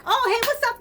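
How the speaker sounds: very high, bright mock hello; chirpy greeting. Answering her call, a very high mock friendly greeting. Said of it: "And they like".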